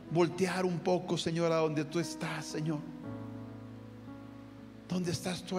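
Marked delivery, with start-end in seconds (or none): none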